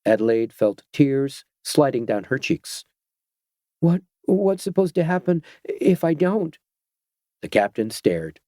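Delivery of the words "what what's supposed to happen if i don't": tearful fear. Acted. Frightened girl, tearful.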